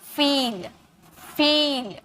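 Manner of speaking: "फील" with the ee stretched out; long ee. Shown as a demonstration. Teacher modelling "फील" for students, long ee held. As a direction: draw out the long vowel in "फील". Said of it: "थोड़ा तानिए".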